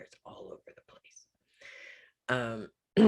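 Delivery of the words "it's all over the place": breathy whisper, trailing off; whispered aside. Real, not acted. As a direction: let it fall away into a whisper. Voice sinks into a whisper.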